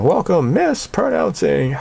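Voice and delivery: high, loud mock pageant emcee; hammed-up emcee welcome; pageant host. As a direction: announce it like a beauty pageant host. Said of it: "like a beauty pageant".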